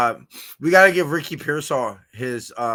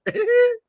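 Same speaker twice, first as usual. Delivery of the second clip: giddy squeal; 7 dB louder than usual. Real, not acted. Giddy about football.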